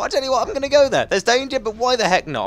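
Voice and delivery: high, loud, hammed-up reckless hero; mock a gung-ho hero, fast and high; Jason Brody. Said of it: "You know what Jason Brody's like".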